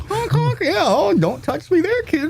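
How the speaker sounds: high, slow clown voice; silly clown. Pretend. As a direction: play a goofy hospital clown. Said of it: "pull out my best Patch Adams".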